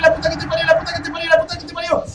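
high, loud repeated curse; real gamer rage; furious cursing. Real, not acted. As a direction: rage at the killer, over and over. Raging at the game.

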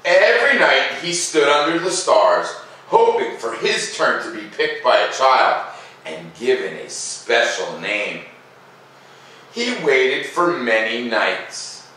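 slow, lilting read-aloud voice; gentle storyteller pacing, each phrase drawn out; story narration. A narrator's reading. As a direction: read it like a bedtime story for kids. Reading the picture book aloud.

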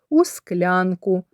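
ordinary delivery

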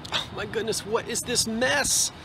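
ordinary talk, nothing marked

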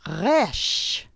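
Resh with a rolled throaty r from the back of the throat; guttural Resh. Shown as a demonstration. Teacher modelling guttural Resh for learners. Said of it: "repeat after me".

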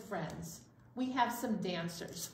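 ordinary delivery, nothing marked

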